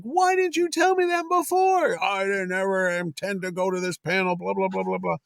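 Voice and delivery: high-pitched mock complaint; retold exasperation. Organizer and artist arguing over the panel, acted high exasperation. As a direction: act out exasperated protest, sliding into blah-blah.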